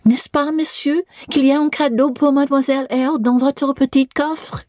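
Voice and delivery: put-on high, eager French child's plea; eager little girl. A narrator's reading. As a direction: cry out like an excited little girl begging. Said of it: "cried out".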